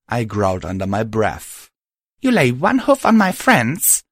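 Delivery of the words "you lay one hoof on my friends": high, loud warning; high angry threat. Acted. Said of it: "I started to say".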